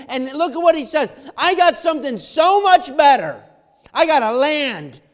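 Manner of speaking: loud, high-pitched pulpit preaching; rising revival preacher; preaching at full force. Acted. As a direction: preach it out to the whole room.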